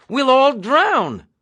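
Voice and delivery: Nately fearing drowning in the jeep, panicked; panicked cry. Acted. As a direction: wail it in panic. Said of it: "he cried".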